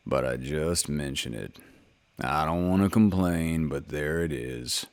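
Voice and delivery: very low, slow, fading donkey complaint; low, slow gloomy Eeyore. A narrator's reading. Eeyore mopes to the others about his cold tail, low and slow. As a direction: complain as if you'd never dream of complaining.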